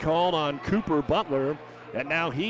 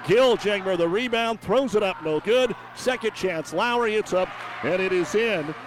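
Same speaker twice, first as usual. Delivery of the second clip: urgent, raised-pitch running call; excited play-by-play; 4 semitones higher, 4 dB louder than usual. Real, not acted. Announcer follows rebounds and second chance, excited. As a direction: ride the suspense through each shot attempt. Live call of scramble under hoop.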